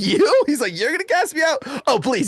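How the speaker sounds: high, incredulous mock scoff; imitating a taunting demon. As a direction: jeer at a feeble challenger, pitch jumping up. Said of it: "starts laughing at my friend".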